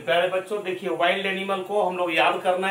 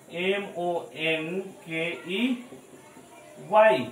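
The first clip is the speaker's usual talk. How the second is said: slow spelling; much slower than usual. Shown as a demonstration. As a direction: read each letter slowly. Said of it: "तो पहला क्या होगा".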